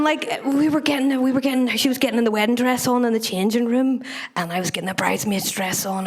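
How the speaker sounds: ordinary delivery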